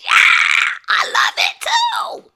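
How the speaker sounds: very high, loud fan squeal; ecstatic screaming. Retold or imitated. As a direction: scream it like a fan losing control. Acting out her swooning fan reaction.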